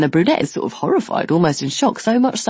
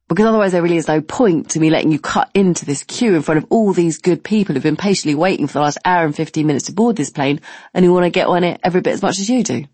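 ordinary talk

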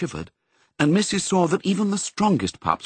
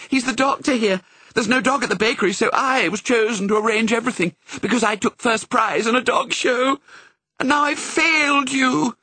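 put-on a much higher, anxious young dog voice; distressed setter; 12 semitones higher than usual. The setter apologising to the Dalmatians for failing them, distressed. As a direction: rush through it, sure you've let them down.

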